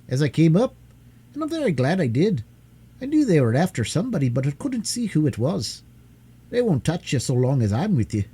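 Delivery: lightly voiced young miner boy, friendly. Curdie the miner boy speaks.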